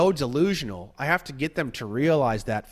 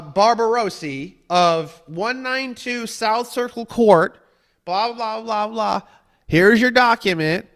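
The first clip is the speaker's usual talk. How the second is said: higher and louder, a smug taunting read-out; mocking the scammer; 6 semitones higher, 5 dB louder than usual. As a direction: ham up a gloating scammer reading your address. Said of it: "they say".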